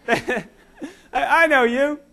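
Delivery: amused, half laughing; laughing recognition. Retold or imitated. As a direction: speak it through a laugh. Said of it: "and they go".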